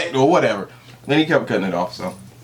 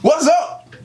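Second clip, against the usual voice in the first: upbeat, high hype-man opener; show host intro; 8 semitones higher than usual. Acted. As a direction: kick off the show with energy.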